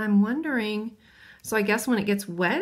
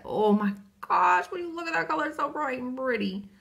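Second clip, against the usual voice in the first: amazed; 5 semitones higher than usual. Real, not acted. Amazed at bright orange.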